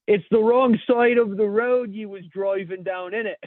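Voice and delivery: loud, high, drawn-out English cop; shouted mock English accent. Cop shouting back at an English driver, mock-English shouting. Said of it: "the cop says, while shouting".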